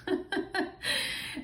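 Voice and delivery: a high, airy burst of laughter; breathy high laugh. Real, not acted. Laughing at her own cheeky line to viewers. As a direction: let a real laugh slip out.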